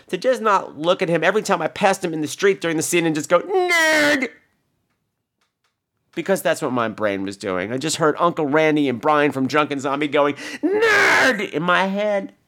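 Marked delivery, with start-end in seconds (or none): 3.4-4.3 very high, drawn-out jeer; mock heckling yell. Acting out an urge to heckle. 10.6-11.5 high-pitched, stretched mock holler; imitated heckling shout. Imitates voices heard in head.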